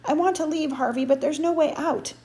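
high, loud, desperate complaint; worked-up and trapped; frantic, frustrated. A narrator's reading.

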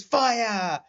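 high-pitched anime battle cry; anime shout. Retold or imitated. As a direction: yell it like an anime hero. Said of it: "You're sounding like the guy".

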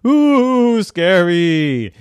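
high, loud spooky "ooh"; spooky storyteller. Acted. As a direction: draw it out like a playful scare.

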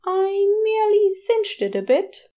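breezy angel brushing off the goose business; airily. Acted. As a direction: toss it off as if it were nothing. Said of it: "before continuing airily".

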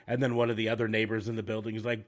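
ordinary talk, nothing marked

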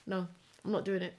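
ordinary talk

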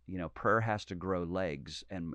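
ordinary talk

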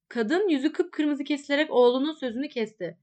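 usual speaking voice

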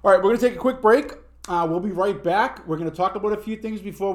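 loud, fast break announcement; announcer read. Acted. Podcast host announcing a break, loud and fast.

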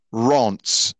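Reims said as French "ronce", nasal vowel; French pronunciation. Shown as a demonstration. Host revealing the real name to listeners, Reims as "ronts". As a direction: say Reims like "ronts", one syllable. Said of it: "It's actually pronounced".

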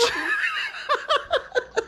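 big high laugh that fades; high laughing burst. Real, not acted. Telling the story, bursting into high laughter. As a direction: crack up at the story.

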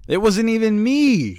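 high, drawn-out protest; exaggerated high-pitched innocence; mock denial.

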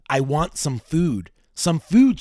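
slow plea rising to a loud cry. A narrator's reading. Hungry ghost begs the investigator for food, crying out. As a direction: read it as a hungry, insistent demand. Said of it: "she cried".

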